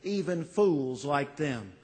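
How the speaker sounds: an urgent plea that softens at the end; pleading prayer. Retold or imitated. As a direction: cry out to the Father, then ease off. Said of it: "A pleading prayer".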